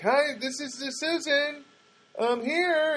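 high-pitched imitation of a woman announcing herself; Susan. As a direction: do a high, mock caller's voice at the gate. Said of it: "she was like".

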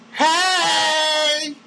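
high, drawn-out goofy greeting; Jerry Lewis voice. Retold or imitated. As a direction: stretch it out high like a manic slapstick comic. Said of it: "Won't sound like Jerry Lewis".

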